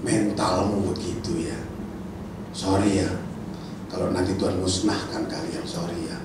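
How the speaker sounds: soft, low, slow disdain; calm contempt. Acted. Moses answering the crowd, soft, low and disdainful. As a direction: dismiss them coolly. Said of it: "Musa bilang".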